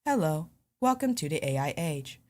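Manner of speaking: emotionless, measured AI announcer; robot voice. Shown as a demonstration. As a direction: switch into a polite machine greeting. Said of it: "I can go from person to".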